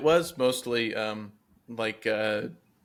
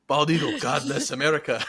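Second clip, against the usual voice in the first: broken English; 3 semitones higher, 3 dB louder than usual. Retold or imitated. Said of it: "that's when the guy said".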